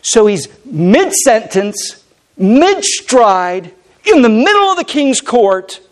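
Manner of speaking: high, punched phrases with pauses between; preacher building urgency, phrase by phrase; emphatic preaching. Real, not acted. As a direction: drive each phrase home to the congregation. Preaches at full force.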